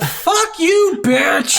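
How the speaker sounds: high, sarcastic mock retort; mock snarky comeback. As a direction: snap back at the ungrateful NPC for a laugh. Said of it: "And I'm like".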